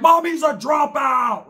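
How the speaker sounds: high, slow, shouted line; mock shout. Son shouting back at his screaming mom, slow high yell.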